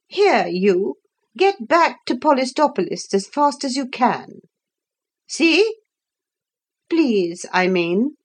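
high, bossy command to the Hippogriff; bossy order. Acted. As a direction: order it off sharply, then correct herself. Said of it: "she added".

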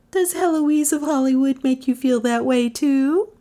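high, impish teasing. A narrator's reading. Passenger interrupting a sentimental confession, impishly. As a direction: tease impishly in a high woman's voice. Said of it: "Melissa interrupted impishly".

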